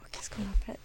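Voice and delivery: hushed, voiceless self-question; under the breath, hunting for a word; whispered aside. Real, not acted. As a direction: whisper it to yourself, searching for the word.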